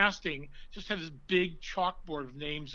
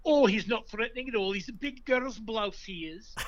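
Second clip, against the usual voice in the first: put-on British accent; 7 semitones higher, 3 dB louder than usual. Quoting a British phrase about himself, mock English accent. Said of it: "what the British ... an English phrase".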